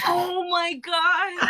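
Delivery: drawn-out loud gasp of realisation; slow stunned shout; surprised. Real, not acted. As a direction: it finally clicks, out loud. Realising the name's meaning.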